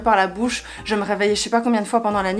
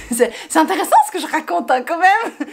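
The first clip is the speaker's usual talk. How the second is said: laughing; 6 semitones higher than usual. Real, not acted. Laughing at own boring story.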